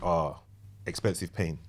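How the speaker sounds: ordinary delivery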